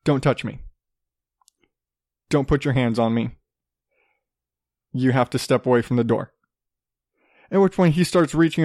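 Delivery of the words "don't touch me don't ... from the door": low, measured, controlled warning; retold calm, even boundary-setting. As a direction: keep it calm and firm, pitched down. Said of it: "I'm just calmly like".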